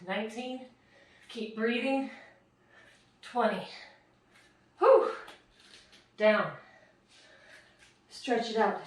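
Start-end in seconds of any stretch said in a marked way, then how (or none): 4.8-5.1 high, loud breathy blow-out of relief; loud relieved whew. Real, not acted. Coach to class after the set, loud relieved whew. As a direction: let the air burst out after the last rep. Finishing a hard plank set.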